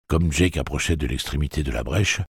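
ordinary delivery

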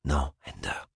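soft, low murmur. A narrator's reading. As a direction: quietly warn him. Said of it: "Valentine murmura".

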